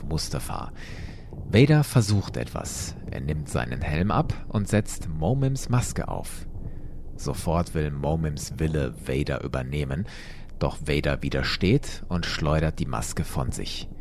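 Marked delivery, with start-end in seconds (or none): none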